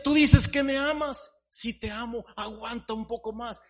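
high, loud cry of a suffering teacup; high, loud anguished teacup. Acted. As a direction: cry out in pain. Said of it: "Y le decía".

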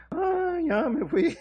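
high whiny fake sob, slow; mock crying. Mocking a believer who leaves crying. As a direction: whimper it like a crybaby.

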